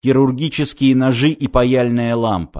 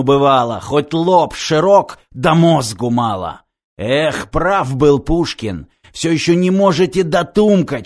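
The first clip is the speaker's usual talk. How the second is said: mocking commandant; 2 semitones higher than usual. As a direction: sneer down at useless underlings. Commandant mocks his henchmen.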